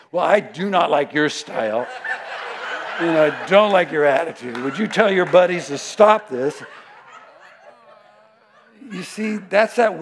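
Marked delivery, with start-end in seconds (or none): 0.1-6.6 louder stern telling-off; firm rebuke. Retold or imitated. To the surfer who paddles up, stern louder rebuke. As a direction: put him in his place.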